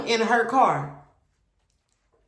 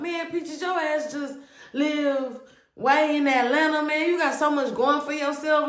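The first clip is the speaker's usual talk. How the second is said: lightly voiced, higher mocking imitation; mock boyfriend; 8 semitones higher than usual. Retelling her boyfriend's excuse, mildly mocking him. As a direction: parody his excuse lightly. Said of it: "He was just like".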